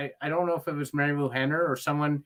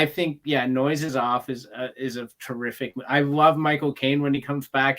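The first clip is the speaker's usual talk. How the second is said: ordinary talk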